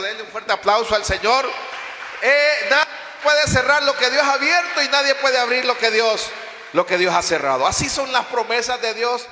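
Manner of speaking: preacher shouting to the congregation; preaching at full force. Acted. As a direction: rouse the church to applause. Preacher calls for applause.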